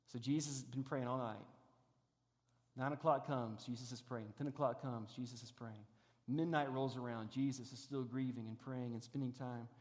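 softly retelling the long night of prayer; hushed storytelling. Acted. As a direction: pull the room in close with a quiet telling. Quiet low retelling of the night.

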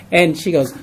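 usual speaking voice

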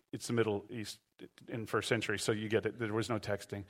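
dropped low and soft as a wry aside; soft, amused side comment; quiet aside. Real, not acted. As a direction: tuck this in softly, half to yourself. Quiet joking aside to congregation.

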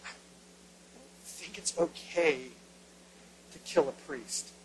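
slow, soft, unsure mumble; hesitant murmur. Retold or imitated. Israelites doubting the order to kill a priest, softly hesitant. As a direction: back away from the order, quietly. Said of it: "look around like".

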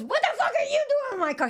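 high startled shriek back; man's startled yell. Retold or imitated. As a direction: echo her shriek in alarm. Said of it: "And he goes".

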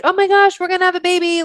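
high-pitched excited squeal of news; acted giddy enthusiasm in a high voice; enthusiastic. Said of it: "we start out really enthusiastically".

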